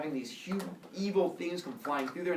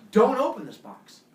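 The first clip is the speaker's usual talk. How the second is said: stern warning; 8 semitones higher, 10 dB louder than usual. Acted. Zeus giving stern box orders.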